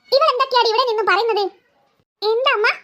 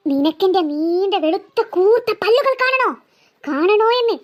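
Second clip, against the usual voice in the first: ghost voice; 4 semitones lower, slower than usual. Acted. Ghost shows fangs.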